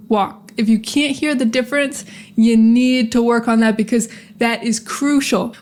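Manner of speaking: ordinary talk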